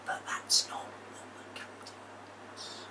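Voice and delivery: loud stage whisper; hushed conspiratorial pirate whisper; toddler whisper. Acted. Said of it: "whisper loudly so they can hear".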